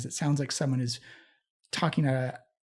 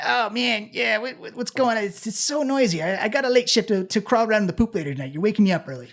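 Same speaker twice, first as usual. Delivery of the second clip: raised-pitch voice of a rat woken early; grumbling rat; 5 semitones higher than usual. Acted. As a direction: complain as a put-upon little rat. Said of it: "you see one of the rats just come".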